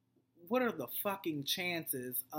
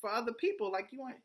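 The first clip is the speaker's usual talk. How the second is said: much higher, whiny mock voice, almost crying; mocking Tierra; 8 semitones higher than usual. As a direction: mimic her in a high whiny mocking voice. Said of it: "And Tierra's like".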